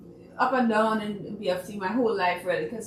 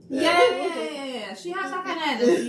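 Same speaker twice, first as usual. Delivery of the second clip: laughing and louder, quicker at the end; 8 semitones higher, 4 dB louder than usual. Real, not acted. Guest laughing about Miss Christine's persuasive energy. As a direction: laugh it out with delight.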